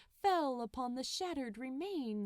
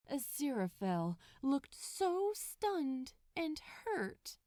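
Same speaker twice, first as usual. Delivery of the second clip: ordinary delivery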